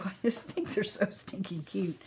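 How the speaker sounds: breathless gushing whisper; hushed adoring whisper. Real, not acted. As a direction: whisper it like a secret about the cute dogs. Gushing over the dogs, whispered.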